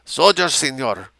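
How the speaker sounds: put-on high voice with Mexican accent; temporizing Mexican officer. A narrator's reading. Mexican officer placates the civilian, accented and temporizing. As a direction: stall politely in a Mexican accent. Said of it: "the Mexican temporized".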